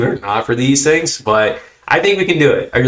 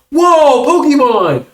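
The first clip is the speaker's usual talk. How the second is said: high, loud gasp of amazement; excited kid; 5 dB louder, much slower than usual. Retold or imitated. Host imitating kids spotting the card pack, excited. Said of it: "very excited about Pokemon".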